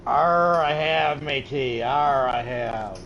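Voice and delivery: loud hammed-up pirate growl. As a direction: ham it up like a pirate. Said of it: "practicing your pirate voice".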